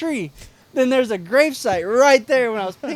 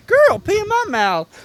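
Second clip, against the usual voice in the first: mock girl voice; 12 semitones higher, 3 dB louder, much slower than usual. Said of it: "mocking the girl's complaint".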